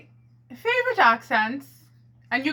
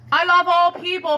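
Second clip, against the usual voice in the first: ordinary talk, nothing marked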